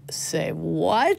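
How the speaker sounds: breathy, disbelieving whisper of say what; incredulous whisper. Retold or imitated. Mother whispering her disbelief at the teacher's [name] project.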